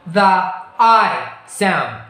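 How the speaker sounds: slow "the I sound", the I stretched into a long glide; drawn-out vowel demo. Shown as a demonstration. Teacher presenting the diphthong to the class, slow and drawn out. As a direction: stretch "I" slowly so the diphthong is heard. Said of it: "the E sound and also".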